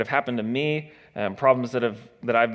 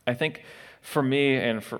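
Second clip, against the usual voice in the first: ordinary talk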